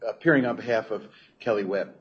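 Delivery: usual speaking voice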